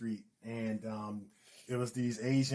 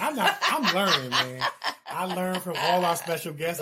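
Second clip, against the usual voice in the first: laughing through the words; 4 semitones higher, 5 dB louder than usual. Real, not acted. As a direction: chuckle while talking. Laughing modest reply.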